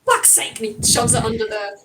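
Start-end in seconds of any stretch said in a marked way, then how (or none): 0.0-0.6 sudden loud angry outburst, higher; angry curse. Acted. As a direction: snap out the curse in pain. Lau startled by alarm clock.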